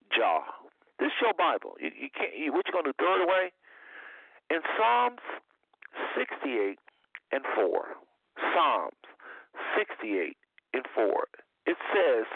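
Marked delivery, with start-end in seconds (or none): none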